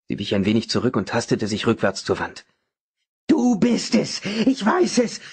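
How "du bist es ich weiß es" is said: high, frantic bellow; shrieking, raging old man; furious roar. A narrator's reading. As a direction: yell the accusation at her. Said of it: "brüllte er".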